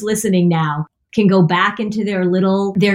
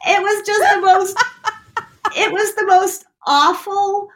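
high voice breaking up with real laughter; talking through laughter; 13 semitones higher than usual. Real, not acted. Telling her awkward hotel story, helplessly laughing through the words. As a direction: try to get the words out while laughing. Still laughing from the retold moment.

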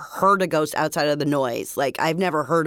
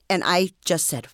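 usual speaking voice

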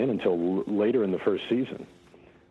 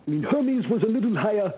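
higher Hermes-style character voice, put on; early Hermes voice; 10 semitones higher than usual. Shown as a demonstration. Showing the hosts how Hermes first sounded, higher voice. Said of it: "he sounded something like this".

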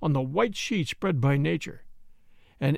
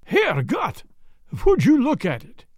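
put-on higher, louder German-accented gasp of horror; 5 semitones higher, 4 dB louder than usual. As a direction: Jan sees the tent rise, in a German accent. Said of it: "The horror in John's voice".

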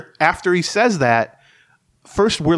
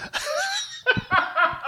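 high burst of laughter; 5 dB quieter than usual. Real, not acted. Laughing at his own story.

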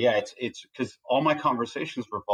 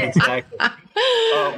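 laughing; 6 dB louder, much slower than usual. Real, not acted. Laughing at the bully joke.

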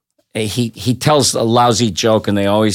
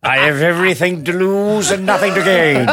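fussy theatrical voice; 7 semitones higher than usual. Retold or imitated. Voicing Jonathan Harris.

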